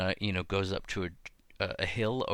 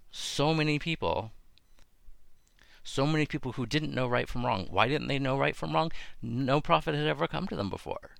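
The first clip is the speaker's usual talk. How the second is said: lightly impassioned, higher-pitched appeal; mildly pleading, pitched up; earnest plea; 6 semitones higher than usual. Acted. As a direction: press the point in a raised pitch. Voicing God's plea for the city.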